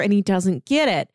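usual speaking voice

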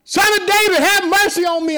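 high, loud plea; crying out. Real, not acted. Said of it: "I'm just crying out".

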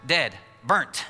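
ordinary talk

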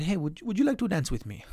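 put-on slipped into a faint Spanish accent; quick, lightly accented invitation; a hint of Antonio Banderas's Spanish accent; accidental accent. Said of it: "I didn't mean to do the accent".

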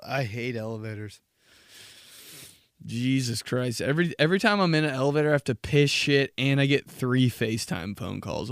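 usual speaking voice

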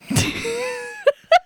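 high, wild helpless cackle; laughing uncontrollably, near tears; hysterical laugh. Real, not acted. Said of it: "Why are you laughing so hard?".

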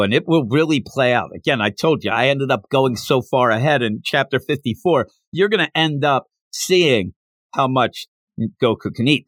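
ordinary delivery

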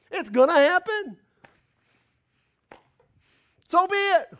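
loud, high preacher cadence; preacher exhorting the church loudly; full-force preaching. Acted. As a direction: preach it out to the congregation.

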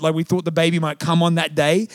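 normal talking voice

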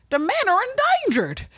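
high, put-on Southern twang; hammed-up fake Southern; mock Southern accent. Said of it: "my racist accent, quote unquote".

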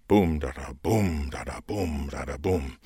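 each boom punched, each da thrown away; a chanted rhythm, boom da da boom; rhythmic stress on boom. Shown as a demonstration. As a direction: beat it out like a drum, boom on the strong beat. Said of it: "emphasize the first word of the parallel structure".

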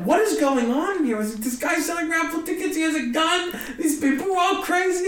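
high-pitched incredulous outburst; mock alarm. Comic realising the booker is armed, in high alarm. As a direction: act out bewildered panic at a crazy gig. Retelling his bewildered alarm at the gig.

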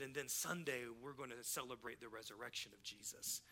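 very soft and low, trailing down; hushed retold explanation. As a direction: confide it quietly to one listener. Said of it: "I'm saying this as we're kinda walking down this aisle".

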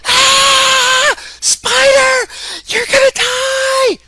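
very high, loud panicked screaming; hysterical shriek. Retold or imitated. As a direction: shriek in over-the-top terror. Parent freaks out over spider.

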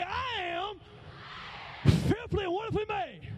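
high-pitched, sassy affirmation; proud, high call-out with sass; with attitude. Shown as a demonstration. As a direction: lead the women with some attitude. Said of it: "Say it with some attitude".

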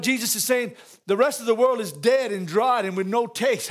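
ordinary talk, nothing marked

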